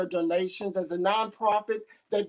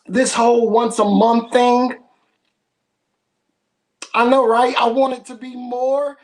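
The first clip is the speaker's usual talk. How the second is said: loud excited; 14 dB louder than usual. Real, not acted. Host hyping crowd about event.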